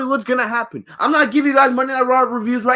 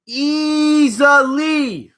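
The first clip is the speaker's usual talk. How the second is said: easily dragged out very slowly; slow, emphatic easily; slow stretched easily; 6 semitones higher, much slower than usual. Real, not acted. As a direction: lean hard on easily and stretch it. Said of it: "how many times I say that".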